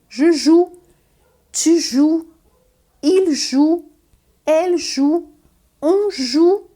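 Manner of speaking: each joue ending in a pure ou, final e and s silent; French conjugation drill. Shown as a demonstration. Teacher recites jouer for students, mute endings. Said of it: "o verbo jouer".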